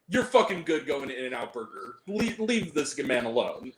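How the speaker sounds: ordinary talk, nothing marked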